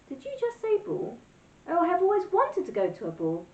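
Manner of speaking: wolf swooning over going to a ball; thrilled wolf. Acted. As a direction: gush with sudden longing.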